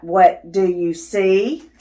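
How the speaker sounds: ordinary delivery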